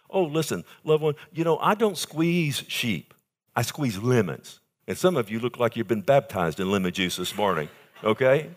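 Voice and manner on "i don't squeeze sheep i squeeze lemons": slow punchline. Real, not acted. Punchline about offerings.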